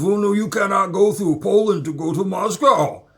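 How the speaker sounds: loud, high mock official's voice; imitated refusing official. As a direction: mimic an official loudly forbidding it. Acted official refusing passage.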